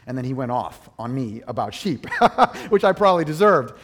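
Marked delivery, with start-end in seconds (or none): 2.1-2.6 bright burst of laughter; real embarrassed laugh. Real, not acted. Laughing at his own gaffe. 2.7-3.7 laughing. Real, not acted. Laughing at his own gaffe.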